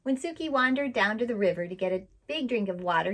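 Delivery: plain reading voice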